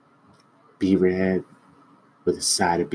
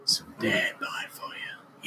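sultry whisper; 7 dB quieter than usual. Pretend. Mock seduction game.

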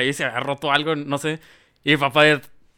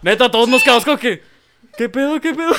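laughing; 10 semitones higher, 6 dB louder than usual. Real, not acted. Retelling family shock, laughing.

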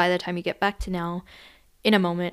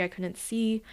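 ordinary talk, nothing marked

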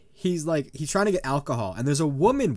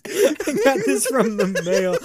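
laughing; 8 semitones higher, 5 dB louder than usual. Real, not acted. Echoing the lady, laughing.

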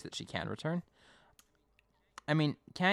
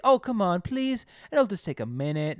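higher, louder begging; pleading; 8 semitones higher, 7 dB louder than usual. Retold or imitated. Simon begging Connie to hold the sprayer, higher and louder. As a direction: beg her eagerly for a favour. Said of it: "He's like".

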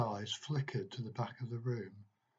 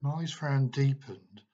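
ordinary delivery

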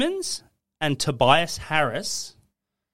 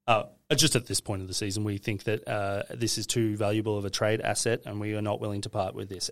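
official statement voice; 6 semitones lower, 8 dB quieter than usual. Retold or imitated. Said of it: "Did they speak in that voice?".

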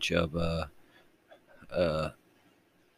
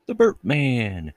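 stretched, higher announcer boom; hammed-up announcer; 7 semitones higher, 3 dB louder, slower than usual. Podcast host hyping himself as announcer. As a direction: drag out the name like a host. Announcer-style self intro.

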